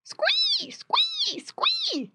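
very high-pitched piglet squeal, repeated. A narrator's reading. Piglet grabbed tightly by the farmer, squealing. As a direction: squeal like a squeezed little pig. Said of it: "squealed Squinty".

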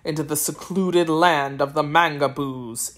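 a cold ruler demanding answers, deep and unhurried; deep, deliberate sorcerer interrogating the children; stern sorcerer. Acted. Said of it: "he asked sternly".

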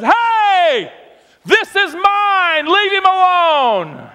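high, loud, slow booming command; God shouting. Acted. God shouting down at the enemy, booming. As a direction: roar it down from heaven. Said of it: "God shouts down and says".

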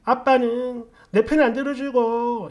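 put-on high, drawn-out childish complaint; sulky child. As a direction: grumble like a kid whose dad won't take sides. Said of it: "어린 마음에는 저는 그게 싫었어요".

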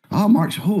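a casual stranger pleasantly surprised; mock Instagram guy. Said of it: "goes to my stuff and goes".

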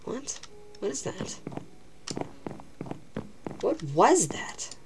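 slow frightened whisper; scared whisper. Real, not acted. Frightened gamer hearing a sound, whispering slowly. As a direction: whisper it, spooked. Startled by noise in game.

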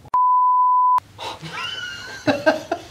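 high shrieking burst of laughter. Real, not acted. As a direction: explode into a screaming laugh. Reacting to cousin photo.

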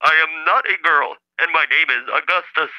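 stilted speech-computer voice, a touch affronted; mildly offended synthetic voice. Acted. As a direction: protest word by word like a talking machine. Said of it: "I think he's getting a little offended".

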